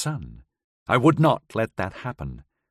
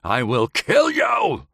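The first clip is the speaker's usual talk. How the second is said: higher, slow snarled threat with KILL hit hard; furious threat; 7 semitones higher, slower than usual. A narrator's reading. Howard screams at Kitty from the floor, slow furious threat. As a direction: rage breaking through the pain. Enraged threat after whimper.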